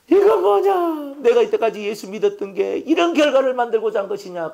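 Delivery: stretched, high despairing cry; anguished outcry. Acted. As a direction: cry out as if the world just collapsed. Job's despairing cry in sermon.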